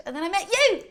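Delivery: high, loud burst of glee; delighted exclamation. Real, not acted. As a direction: land it as a happy climax. Delighted climax addressed to friend.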